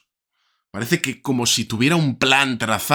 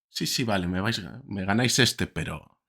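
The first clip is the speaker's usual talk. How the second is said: put-on soft, offhand humouring of kids; imagined champion indulging children, voice dropping off; unbothered calm; 6 dB quieter than usual. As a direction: concede it quietly, trailing away. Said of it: "externaliza una tranquilidad como si estuviera jugando con críos".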